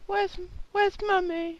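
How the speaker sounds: put-on high squeaky little kid voice; child voice. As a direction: play a tiny child on the phone. Voicing the child.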